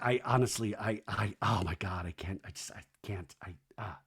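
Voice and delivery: low, faltering, fading almost to a whisper; imitated overwhelmed stammer, very hushed at the end; mock speechless. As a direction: trail away helplessly, stammering softly. Imitating Zach lost for words.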